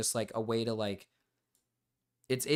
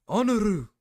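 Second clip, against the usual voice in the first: honor in a hammed-up mock Japanese accent, much higher and louder; 8 semitones higher, 7 dB louder than usual. Shown as a demonstration. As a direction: honor barked high and loud with a mock Japanese accent, the bolder second try. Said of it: "I can't do the Japanese accent".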